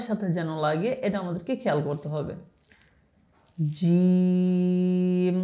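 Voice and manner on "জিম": জিম held very long, three alif lengths; drawn-out letter. Shown as a demonstration. Teacher drawing out জিম for the class. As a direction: stretch জিম out slowly. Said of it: "তিন আলিফ পরিমাণ টানবো".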